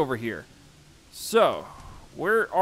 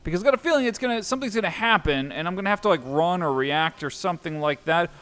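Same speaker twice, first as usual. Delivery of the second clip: ordinary talk, nothing marked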